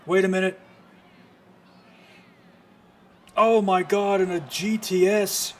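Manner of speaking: loud, high, genuinely stunned; real shock on the call; excited shout. Real, not acted. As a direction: yell in disbelief at the ring. Near-fall in the match.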